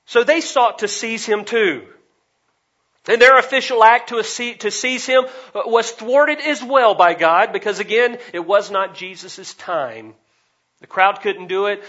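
loud, high preaching at full force; emphatic preaching. Real, not acted. Preacher hammering home the rivalry to the congregation, loud and emphatic. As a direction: drive each phrase home at full voice.

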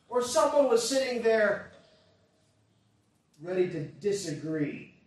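loud, emphatic sermon delivery; forceful preaching. Real, not acted. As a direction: drive each point home to the congregation.

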